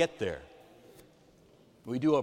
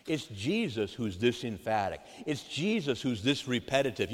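usual speaking voice

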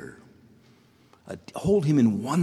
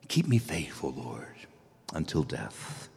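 hushed prayer; 6 semitones lower, 6 dB quieter than usual. Real, not acted. Closing a prayer softly.

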